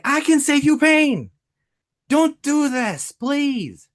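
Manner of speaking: high, forceful pleading, slowing at the end; urgent plea. Shown as a demonstration. Coach showing TikTok appeal to suffering customers, urgent plea. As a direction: beg them urgently, pitched well up. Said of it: "you hear the, the urgency there".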